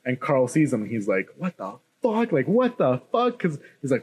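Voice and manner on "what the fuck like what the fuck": mock bewilderment, pitch climbing high; retold disbelief. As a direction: imitate a stunned man, drawn out at the end. Character stunned by loose zombies.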